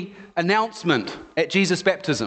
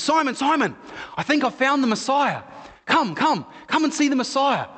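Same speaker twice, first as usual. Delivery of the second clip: higher, eager calling; excited brother; 6 semitones higher than usual. Retold or imitated. Andrew calling brother Simon, excited and eager. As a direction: urge him to come see.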